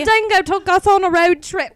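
high, very loud mock Aussie; Australian accent. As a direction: belt out a hammed-up Australian accent. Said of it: "What's an Australian accent?".

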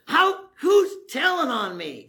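mock outraged king. Preacher voicing an angry king demanding who betrayed him. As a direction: play the king caught off guard and fuming. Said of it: "the king gets really mad".

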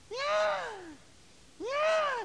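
very high, loud imitated wail; mock squalling. Preacher imitating daughter at testimony meeting, squalling.

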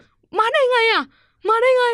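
very high, loud, repeated disbelief; stunned parents. Retold or imitated. Parents at the door, high-pitched stunned disbelief. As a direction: shriek like shocked parents.

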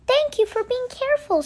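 high, polite little bird voice; Missus Quail's voice. A narrator's reading. Missus Quail thanking the racers, high character voice.

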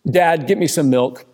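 put-on a whiny child demanding milk; demanding kid voice. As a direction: voice a bossy kid. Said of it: "this is how a conversation ... plays out".

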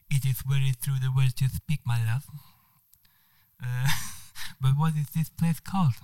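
charming Spanish man with a put-on accent. Spanish diplomat charming the woman on the train, Spanish accent. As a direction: play the suave Spanish diplomat. Manuel answers.

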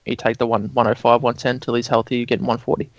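ordinary talk, nothing marked

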